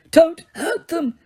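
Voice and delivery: high, slow pleading that swells to a shout; old man begging in anguish; anguished moaning plea. Acted. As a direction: beg them to spare others. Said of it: "as he moaned".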